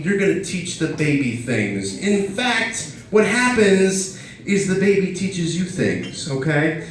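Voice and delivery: ordinary talk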